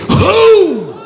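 "Who?" as one high, drawn-out hoot; emphatic WHO. Shown as a demonstration. Preacher showing congregation the response, "Who?" high and emphatic. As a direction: sing out "Who?" high and long. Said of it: "You supposed to say".

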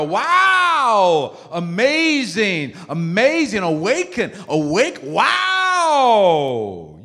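loud, stretched-out cries of wonder; over-exaggerated amazement. Shown as a demonstration. Preacher showing how to affirm others, exaggerated amazement. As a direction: gush with huge falling wows. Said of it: "I'm over-exaggerating".

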